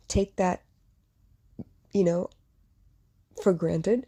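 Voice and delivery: halting, with long pauses as the throat closes up; choked hesitation. Real, not acted. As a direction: struggle to get the words out. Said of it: "I hesitate".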